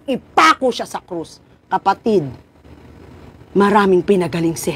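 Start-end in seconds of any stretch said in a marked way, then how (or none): none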